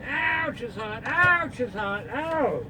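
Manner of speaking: high sharp ouch, then soft; yelping from a burn; pained yelps. Real, not acted. Burned by hot soup.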